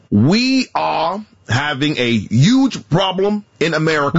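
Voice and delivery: slow, drawn-out politician impression; comic stump-speech drawl; bad Bernie Sanders. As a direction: badly imitate a senator, stretching the words. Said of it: "uh, Bernie Sanders".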